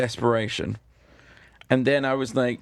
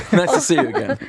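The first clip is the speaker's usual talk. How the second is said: laughing through it, a touch higher and louder; awkward laugh; 6 semitones higher, 6 dB louder, faster than usual. Retold or imitated. Greeting the radio host at judge cuts, nervous laugh. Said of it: "I'm like".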